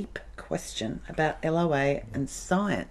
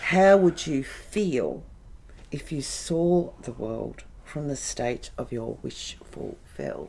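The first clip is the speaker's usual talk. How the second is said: deliberate quote reading; 4 semitones lower than usual. Real, not acted. Reading a Neville Goddard quote on request, deliberate quote reading. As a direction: read it out slowly, fading away at the end.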